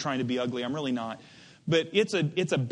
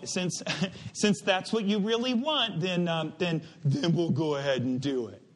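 unmanly, weak father; 3 semitones lower than usual. Acted. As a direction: hem and haw, reluctant to upset the son. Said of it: "I can't read this like a man's response".

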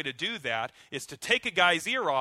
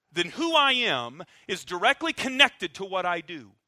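preaching climax; 5 semitones higher, 8 dB louder than usual. Real, not acted. Sermon climax.

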